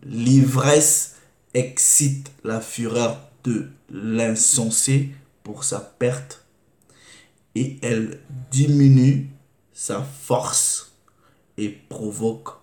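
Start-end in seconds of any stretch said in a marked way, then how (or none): none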